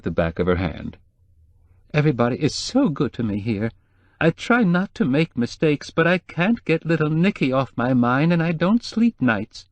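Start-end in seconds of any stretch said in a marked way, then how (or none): 1.9-9.7 higher, anxious housekeeper voice; lightly voiced worried housekeeper; nervous housekeeper. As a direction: play the fretful housekeeper, pitched up.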